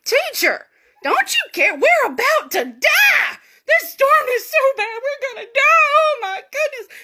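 high, loud hammed-up wail of panic; panicked disciples. Terrified disciples waking Jesus in the storm, panicked shouting. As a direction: shout it in frantic, dramatic terror.

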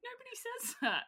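high, surprised what; family reacting to the niece's swearing, mock shocked. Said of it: "we're just like".

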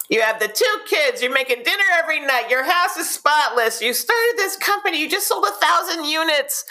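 very high, over-the-top gushing praise; gushing friend. Retold or imitated. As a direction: gush like a flattering best friend. Mock gushing friend answer.

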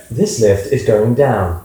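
slow, measured tannoy voice; lift announcement voice. Shown as a demonstration. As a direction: announce it like a lift's recorded voice. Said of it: "He should do the voice for everything".

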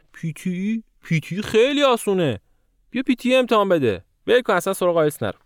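high-pitched voice pushing an easier option; imitating a pushy friend, pitched well up; mock advice-giver. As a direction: talk them out of it in a high, eager mock voice. Mimics people pushing PTE over IELTS.

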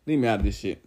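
a woman waving off the drama, low and quiet. Retold or imitated. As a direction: drop your voice low and wave it off. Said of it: "[name]'s like".

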